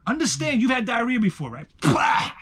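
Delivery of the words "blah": loud, sudden burst noise; voiceless explosive "blah" sound effect; imitated explosive splat. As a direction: blurt out a mock diarrhea explosion.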